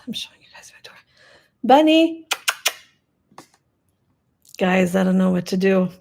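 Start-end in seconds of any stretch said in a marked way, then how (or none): 0.1-1.0 breathy hushed whisper at night. Real, not acted. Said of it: "I'm whispering 'cause everyone's asleep". 1.6-2.3 loud high call across the house; calling the cat. Real, not acted.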